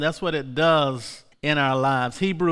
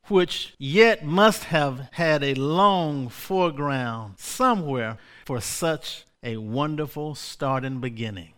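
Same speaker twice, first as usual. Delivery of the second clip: ordinary delivery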